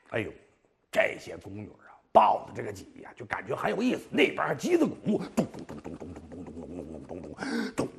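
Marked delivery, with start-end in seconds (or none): 5.3-7.3 imitated drum. 模仿击鼓.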